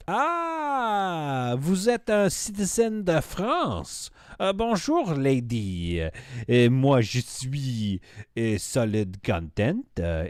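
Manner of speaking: slow, hammed-up French, drawn-out vowels; bad French accent. Streamer showing off French to a viewer, bad French accent. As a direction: put on French, groping for words. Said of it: "my French accent was so bad".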